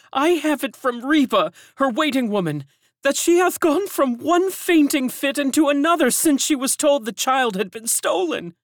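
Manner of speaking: high, loud lamenting woman's voice; grieving elderly servant. A narrator's reading. Servant in the courtyard laments the stolen child, high and loud. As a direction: cry it out as an old servant in mourning.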